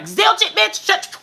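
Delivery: mock hater voice. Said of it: "They like".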